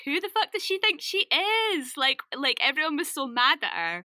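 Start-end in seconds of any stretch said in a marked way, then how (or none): 0.0-1.9 indignant retold outburst, pitched high; mock outrage. Everybody went crazy over her new accent, mock outrage. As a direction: imitate an incensed public, high-pitched. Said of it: "everybody went crazy".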